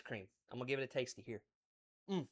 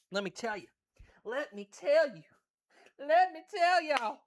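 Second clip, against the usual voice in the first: building excitement; 6 semitones higher, 5 dB louder than usual. Real, not acted. Building excitement, repeated phrase.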